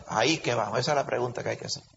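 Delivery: ordinary delivery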